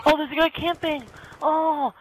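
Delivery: high, loud gamer outrage; real exasperated outburst; outraged complaint. Real, not acted. Outraged at camper in game.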